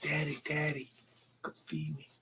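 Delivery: soft pleading whisper; whispered critter voice. Retold or imitated. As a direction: beg quietly like a pet.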